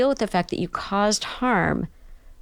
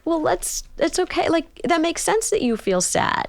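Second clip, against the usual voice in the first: put-on calmly validate the child's sadness; gentle parent; 10 semitones higher than usual. Therapist mimics parent gently accepting a sad child.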